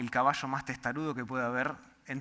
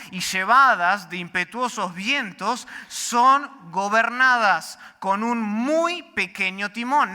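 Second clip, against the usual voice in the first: louder, higher, driving sermon delivery; full-force preaching; 6 semitones higher, 6 dB louder than usual. Acted. Pastor drives home the horse illustration, full-force preaching. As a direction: preach it hard to the back row.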